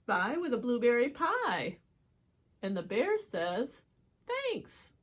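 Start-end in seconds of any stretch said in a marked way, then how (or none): 4.3-4.9 high little thanks. Acted. Bear voiced in story.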